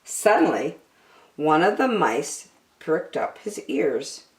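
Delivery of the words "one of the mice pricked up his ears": ordinary delivery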